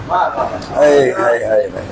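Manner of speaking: low soft sighing lament. Real, not acted. Preacher sighing in sorrow over the Imam, soft and low.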